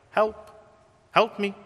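high squeaky cry for help; a timid little man yelping. Retold or imitated. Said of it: "lets out a squeak of a yelp".

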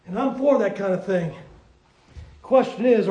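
ordinary delivery

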